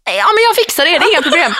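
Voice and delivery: high breezy mock Hanna voice; a light mock Hanna. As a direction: toss it off as Hanna, high and carefree. (härmad Hanna, hög och sorglös)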